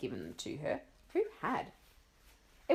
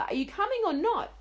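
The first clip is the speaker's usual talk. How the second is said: impatient call; 13 semitones higher, 6 dB louder than usual. Acted. Mother calling upstairs.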